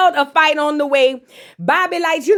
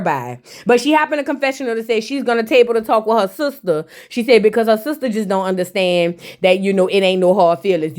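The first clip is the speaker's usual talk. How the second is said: ordinary talk, nothing marked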